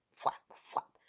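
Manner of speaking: unpitched "flap, flap"; wing-flap noise. A narrator's reading. Lizards dive at children, breathy wingbeat sounds. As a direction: imitate beating wings in breath.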